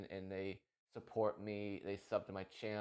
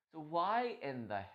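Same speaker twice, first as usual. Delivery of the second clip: usual speaking voice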